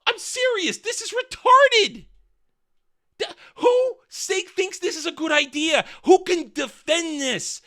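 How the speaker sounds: very high, incredulous rant; exasperated outrage. Real, not acted. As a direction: stretch the words in high-pitched disbelief.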